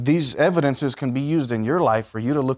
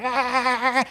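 put-on baby wail; 12 semitones higher than usual. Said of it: "and they're like".

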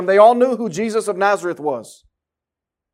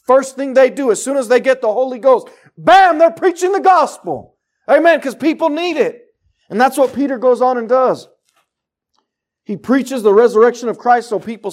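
loud, higher, driving sermon voice; fiery preaching; 6 semitones higher, 4 dB louder than usual. Acted. Preacher rousing the congregation, loud and fervent. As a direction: preach it out with rising fire. Preacher building to full force.

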